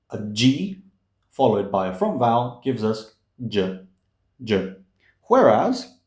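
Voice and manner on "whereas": ordinary delivery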